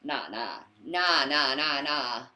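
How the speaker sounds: loud burst of nahs sliding down and fading; descending, dying-away string of nahs; rapid-fire refusal. Real, not acted. Comic refusal on hearing name Damien.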